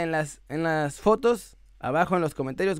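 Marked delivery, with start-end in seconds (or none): none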